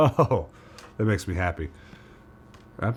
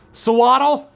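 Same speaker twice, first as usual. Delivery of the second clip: sudden loud yell, much higher; 11 dB louder, slower than usual. Real, not acted. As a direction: shout the name out. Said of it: "I don't know why I yelled that one".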